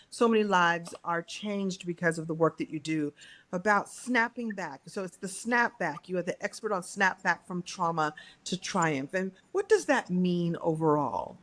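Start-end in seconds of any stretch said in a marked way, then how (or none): none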